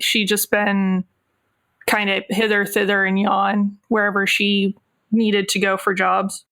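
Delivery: usual speaking voice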